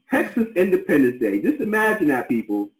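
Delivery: high, excited marvel with a laugh; amazed. Real, not acted. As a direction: marvel at it with real delight. Host amazed by the Texas birthday.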